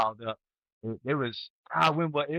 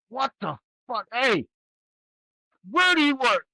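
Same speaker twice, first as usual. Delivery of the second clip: retold outburst, much higher and louder; angry yell; 10 semitones higher, 4 dB louder than usual. Retired NCO yelling at a soldier without headgear, angry. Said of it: "I'm just like".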